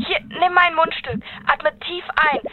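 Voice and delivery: usual speaking voice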